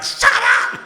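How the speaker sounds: very high, loud squealed outburst; mock shriek. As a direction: imitate her shrieking it. Imitates angry Haley snapping back.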